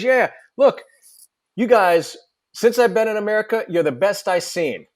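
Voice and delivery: cocky Manc rock star, high and brash. Retold or imitated. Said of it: "you do such a good fucking impersonation".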